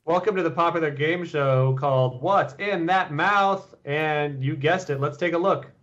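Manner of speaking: hammed-up game show intro; mock game-show host. Host welcomes viewers to a fake mouth game show, hammed-up announcer.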